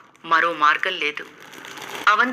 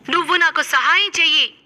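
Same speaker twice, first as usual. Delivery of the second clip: pleading; 9 semitones higher than usual. Acted. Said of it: "దీనంగా అడిగింది".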